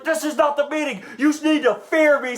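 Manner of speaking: high, shouted threat; mock menacing yell. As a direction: yell it like a tough guy. Driver screaming at passenger.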